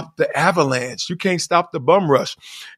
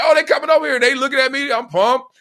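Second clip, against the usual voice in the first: higher-pitched pumped-up chatter; acted eager excitement; 6 semitones higher, faster than usual. Drunk bachelor seeing girls coming over, acted excitement. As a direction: get worked up as they approach.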